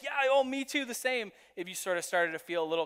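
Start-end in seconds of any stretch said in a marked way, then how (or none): 0.0-1.3 sudden much higher, louder happy "me too"; light up like you just found a match; eager delighted chime-in; excited agreement. Retold or imitated. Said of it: "and they were like".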